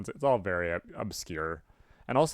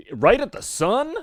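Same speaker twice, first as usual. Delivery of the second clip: high, loud, slow incredulous question; rising, stretched-out exclamation of disbelief; acted disbelief; 10 semitones higher, 10 dB louder, slower than usual. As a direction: blurt it in mock shock. Acted shocked reply to command.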